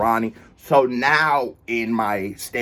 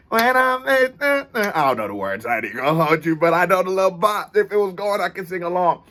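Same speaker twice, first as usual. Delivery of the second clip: ordinary talk, nothing marked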